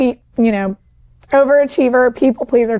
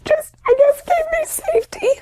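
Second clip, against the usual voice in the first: high, tear-choked voice breaking; real crying. Real, not acted. Guest crying as she tells the host about her dad, voice breaking. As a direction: push the words out through real tears. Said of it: "I just started bawling".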